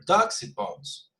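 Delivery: slow take with Ducks pitched high; Betonung variiert. Shown as a demonstration. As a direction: lift Ducks high, then let the phrase fall. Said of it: "at least three different ways".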